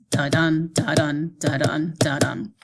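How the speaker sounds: "Da-dun" repeated evenly, short unstressed "Da", longer stressed "dun"; heartbeat rhythm. Shown as a demonstration. Teacher showing students how an iamb sounds, "Da-dun" as a steady heartbeat. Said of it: "it sounds like this. ... which sounds like a heartbeat".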